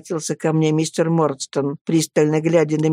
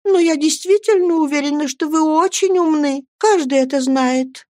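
high-pitched placating woman; objecting mother; 9 semitones higher than usual. A narrator's reading. Mother insists to Jane that she is clever, high and earnest. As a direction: insist sweetly, pitched well up. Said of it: "возразила матушка".

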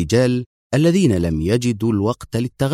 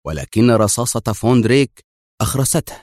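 ordinary delivery, nothing marked

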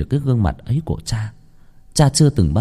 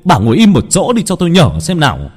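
impatient snap at his wife, louder; scolding; 4 semitones higher, 6 dB louder than usual. A narrator's reading. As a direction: cut her pacing short. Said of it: "Cha tôi sốt ruột quát mẹ". (cha sốt ruột quát vợ)

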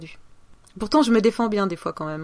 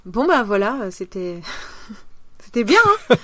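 cheery sign-off; 3 dB louder than usual. Shown as a demonstration. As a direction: chirp it out to your listeners. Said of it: "comment tu l'as conclu, ton émission?".

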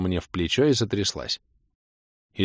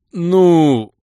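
flustered loud exclamation; 9 semitones higher, 9 dB louder than usual. A narrator's reading.

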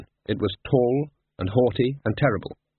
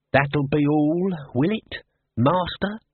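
put-on very slow, huffy old servant; sulky and pointed; put-upon Albert; 5 semitones higher, much slower than usual. Albert leaves his master's tea, slow and huffy.

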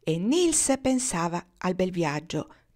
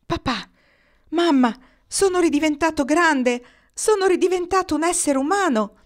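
rising higher to an elated cry; the boy announcing he is human again; joyful shout; 9 semitones higher, 4 dB louder than usual. Acted. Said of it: "gridò". (grido esultante che sale sempre più in alto)

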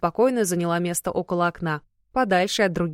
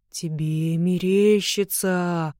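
slow eerie ghostly sing-song; sepulchral voice; much slower than usual. A narrator's reading. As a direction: croon it from beyond the grave. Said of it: "загробным голосом пропел Зак".

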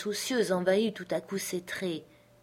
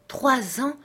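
higher-pitched startled question; surprised cry; 6 semitones higher than usual. A narrator's reading. Jim hearing of three lonely years, surprised. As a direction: catch the shock in the number. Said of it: "m'écriai-je".

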